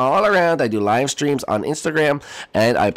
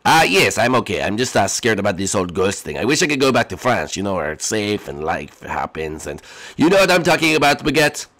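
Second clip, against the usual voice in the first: ordinary delivery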